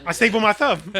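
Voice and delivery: ordinary delivery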